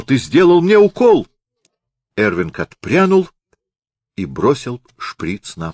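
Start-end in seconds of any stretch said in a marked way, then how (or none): 0.0-1.3 loud, high, panicked cry; feigned shout. Acted. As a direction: yell it out in fake alarm. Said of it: "и закричал".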